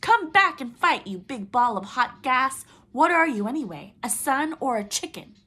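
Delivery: loud, high taunting yell; screaming insults. Acted. Coyote taunting the sun, screaming insults. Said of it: "screaming insults at the sun".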